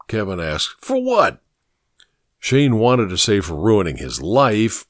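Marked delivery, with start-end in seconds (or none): 0.8-1.4 much higher, baffled incredulous question; genuinely can't believe he owes anything. A narrator's reading. Kevin to Evelyn, asked to apologize, incredulous and higher.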